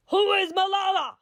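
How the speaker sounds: high, loud demanding yell. A narrator's reading. Second gunman yells at the schoolgirls, high angry yell. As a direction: scream it out to find her.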